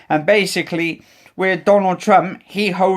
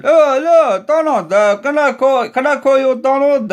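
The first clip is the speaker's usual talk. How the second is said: mock Putin greeting; 6 semitones higher, 3 dB louder than usual. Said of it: "I can imagine them, like".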